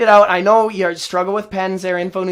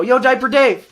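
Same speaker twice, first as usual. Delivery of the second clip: higher, louder re-enacted holler; yelling out a window; 6 semitones higher, 5 dB louder than usual. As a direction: call out to someone down the street. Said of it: "I yell out my window".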